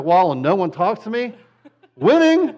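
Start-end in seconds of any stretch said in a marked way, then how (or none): 2.0-2.5 high, loud mock triumph; laughing victory shout; gleeful cheer. Real, not acted. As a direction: crow it like a prize won. Joking about loving timeout.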